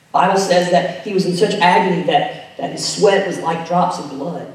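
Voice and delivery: ordinary delivery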